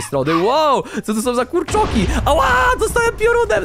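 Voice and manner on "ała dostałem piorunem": high yelp of mock pain; pitched-up startled outcry. Real, not acted. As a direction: cry out as if just zapped. Struck by lightning in game.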